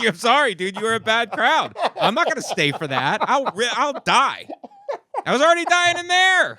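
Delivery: high, loud, laughing through it; mock storm-off. Comedian fleeing the car, loud and laughing. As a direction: bail out laughing at the bad crowd. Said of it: "been like".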